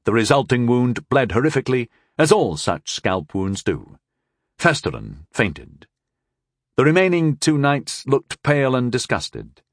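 plain reading voice

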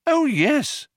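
high, keen little agreement; eager Arthur. A narrator's reading. Arthur assuring the Vogon he liked it, eager. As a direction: agree eagerly, pitched well up.

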